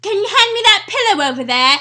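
hammed-up Cockney London voice; Cockney accent. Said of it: "Co-, Cockney".

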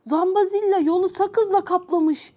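high-pitched, mild storybook shout; shouted alarm. Acted. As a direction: call out the news in alarm. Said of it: "diye bağırdı Parapella".

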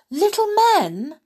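very high, loud princess question; delighted cry. A narrator's reading. Princess hears of visitors and rushes forward, crying out. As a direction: exclaim it in astonished hope.

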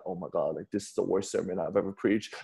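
low, hushed retold thought; inner panic. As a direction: think it aloud under the voice, mildly dismayed. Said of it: "thinking in my mind as I'm preaching".

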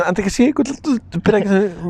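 talking between real hiccups. Real, not acted. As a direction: hiccups breaking up the words. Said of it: "Puta, me dio hipo".

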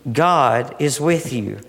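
slowly, each word weighed; slow emphasis. Real, not acted. Preacher slowly assuring the congregation. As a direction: let every word land slowly.

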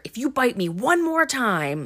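retold threat with mild outrage; mock angry warning. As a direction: act out an exasperated warning. Quoting an angry scuffle overheard.

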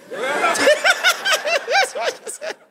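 high squealing laughter through words; can't stop laughing. Real, not acted. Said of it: "before I laugh for you".

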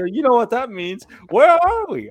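high, loud mock announcer greeting; imitating the announcer. Mimicking the show opener for cohosts, high and loud. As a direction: ham up the show opening in a high voice. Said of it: "the opening of the show".

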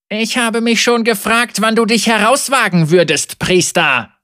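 smiling taunt. Acted. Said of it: "sagte der Ketzer lächelnd".

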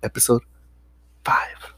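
pause, then drop to a whisper; whispered reveal. Real, not acted. Co-host announcing the episode under review, whispered after a pause. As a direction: hold back the last word, then whisper it. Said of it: "Why the fuck you whispering".

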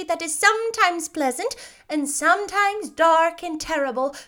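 put-on high, emphatic old lady voice; kindly old witch. A narrator's reading.

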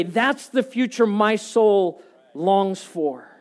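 loud, rising preacher's build; full-force pulpit preaching, voice pushed high. Acted. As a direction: preach it to the whole room with fire.

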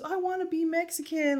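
high, soft imitated child whimper; mock-plaintive little boy voice; crying little boy. Retelling a tearful boy's wish to mom, mock crying. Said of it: "He goes".

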